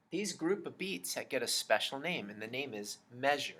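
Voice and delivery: ordinary delivery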